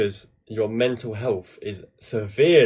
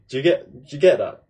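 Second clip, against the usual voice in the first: usual speaking voice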